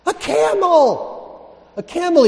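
incredulous exclamation. Acted. Preacher exclaims at the image.

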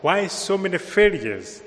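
ordinary talk, nothing marked

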